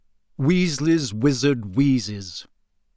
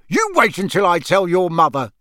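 higher, louder warning father; angry dad's last warning; threat; 4 semitones higher, 5 dB louder than usual. Acted. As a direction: threaten them with their mother.

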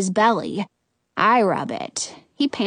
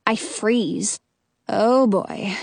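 ordinary delivery

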